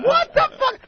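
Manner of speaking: high outraged squeal; outraged yelp. Retold or imitated. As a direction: shriek of disbelief. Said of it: "they'd be going".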